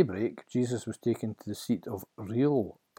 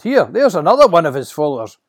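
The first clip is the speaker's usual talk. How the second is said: loud, quick, raised-pitch call; accusing shout; 7 semitones higher, 15 dB louder, faster than usual. Acted. Man by the fire pointing out a follower, shouting. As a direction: shout to expose someone. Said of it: "One shouted".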